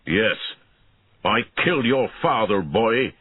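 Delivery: understated shout of defiance; defiant shout. A narrator's reading. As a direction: throw it back at your enemy. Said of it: "he shouted at Einan".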